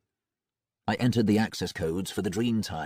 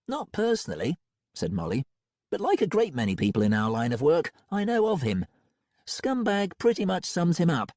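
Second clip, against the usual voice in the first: put-on higher, thoughtful young woman; Molly's own voice; 8 semitones higher than usual. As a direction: muse it aloud as Molly.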